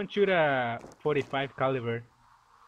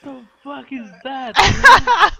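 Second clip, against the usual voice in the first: shocked shout; 10 semitones higher, 15 dB louder, much slower than usual. Real, not acted. Sees flying van.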